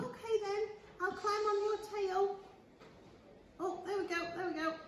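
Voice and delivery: high, soft little cookie voice; timid little gingerbread man; small high voice. Acted. Cookie on the tail.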